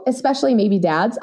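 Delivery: ordinary talk, nothing marked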